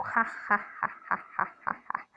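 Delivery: whispery cackle trailing off; a fading wicked-witch laugh; evil witch cackle. Acted. (चुड़ैल की धीमी होती हँसी)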